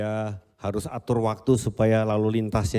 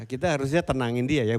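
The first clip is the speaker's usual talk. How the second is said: ordinary delivery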